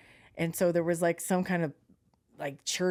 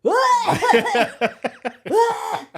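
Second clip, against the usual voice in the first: strained laughing cough; 9 dB louder than usual. Real, not acted. Laugh while trying to cough.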